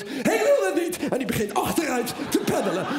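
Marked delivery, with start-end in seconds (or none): 0.0-1.1 high panicked whine; mock terrified dad; father shouting scared. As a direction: refuse in a scared cry. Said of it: "mijn pa schreeuwt".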